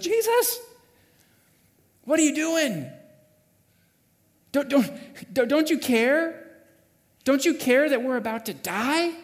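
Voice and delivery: high, loud, urgent plea; bold disciple's alarm. Acted. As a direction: shake him awake with indignant cries. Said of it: "one of the more bold disciples goes over there and actually shakes him".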